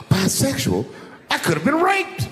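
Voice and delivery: high, hammed-up panic; mock horror. To the crowd, hammed-up horrified squeal. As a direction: squeal it in fake alarm.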